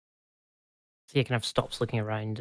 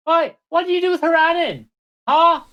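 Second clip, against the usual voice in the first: high, loud shout into the trees; calling out; 9 dB louder than usual. Acted. Thea shouts at the trees from the doorway, high and loud. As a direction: yell it out the door at the forest. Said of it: "stands in the doorway and goes".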